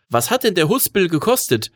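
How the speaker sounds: put-on high-pitched, curious boy; boy's voice. A narrator's reading. Boy suddenly beside the driver asking the price, high and curious.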